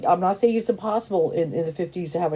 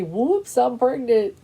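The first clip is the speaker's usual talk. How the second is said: higher-pitched pretend oops; mock surprise; 6 semitones higher, slower than usual. Imagining a pregnancy at fifty-three, mock surprised. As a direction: play a light, comic accidental discovery.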